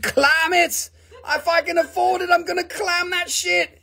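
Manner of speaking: high mock shriek of an excited buyer; imitated scream. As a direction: squeal it like she typed it in caps. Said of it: "She screamed".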